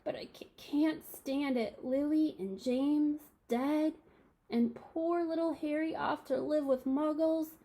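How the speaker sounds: high, slow, tearful lament; stammering through the tears; sobbing giant. A narrator's reading. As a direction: grieve aloud, voice breaking. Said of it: "sobbed Hagrid".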